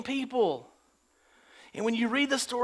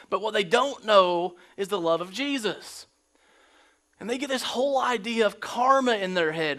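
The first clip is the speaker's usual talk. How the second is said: normal talking voice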